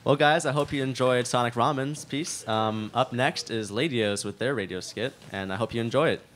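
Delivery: loud, bright show host announcement; announcer voice. Acted. Host introducing the next radio skit, loud announcer voice. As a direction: boom it out to the whole room like a host.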